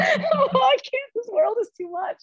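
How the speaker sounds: very high tearful whine through laughter; hammed-up helpless sobbing; mock crying. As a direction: wail as an overwhelmed little kid. Imitating her overwhelmed crying child self.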